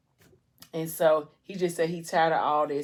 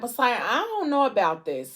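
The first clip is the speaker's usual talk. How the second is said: high-pitched reluctant protest, retold; hesitant; 6 semitones higher, 3 dB louder than usual. Friend hesitates again at tattoo.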